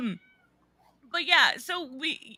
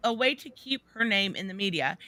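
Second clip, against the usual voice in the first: normal talking voice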